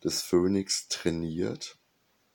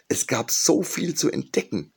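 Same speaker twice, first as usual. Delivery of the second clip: excited call; 10 semitones higher, 4 dB louder than usual. A narrator's reading. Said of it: "riefen sie".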